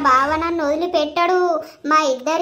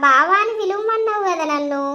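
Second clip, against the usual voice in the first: ordinary delivery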